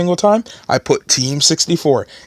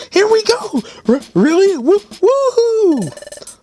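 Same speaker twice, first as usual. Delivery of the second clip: high excited character; much slower than usual. Acted.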